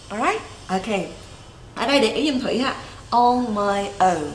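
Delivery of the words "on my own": slow "On my own", "own" gliding o to u; correct pronunciation. Shown as a demonstration. Teacher models for learners, slow "own" with "ou" glide. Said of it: "Own không đọc là on".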